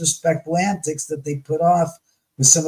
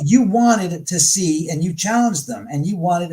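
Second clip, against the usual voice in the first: usual speaking voice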